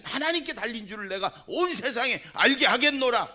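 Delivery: loud, high, rising war cry; defiant battle cry. Acted. 소년 다윗이 거인에게 외치듯, 점점 높아지는 외침. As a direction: roar it out like a boy defying a giant. David defying the giant.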